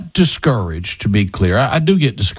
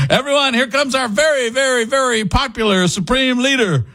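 higher, pompous mock state-TV announcer; 9 semitones higher than usual. Mocking a regime announcer to the caller, pompous. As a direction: herald the leader with fake grandeur.

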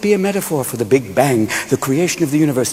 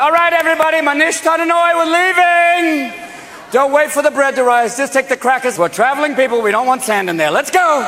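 high-pitched rallying holler; 11 semitones higher than usual. Acted. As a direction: round up the crowd, pitched way up.

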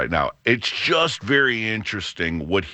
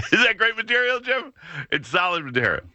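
laughing; 14 semitones higher, faster than usual. Real, not acted. Laughing while asking co-host.

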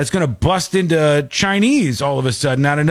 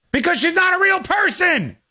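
fed-up yelling at a dumb robot; exasperated shout; 13 semitones higher than usual. Real, not acted. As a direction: shout it like you've lost patience. Yelling at AI actress on screen.

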